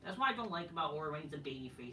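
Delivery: ordinary delivery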